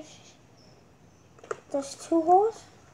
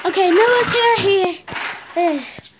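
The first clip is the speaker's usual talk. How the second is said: loud call; 3 semitones higher, 10 dB louder, slower than usual. Real, not acted. Calling out to the camera.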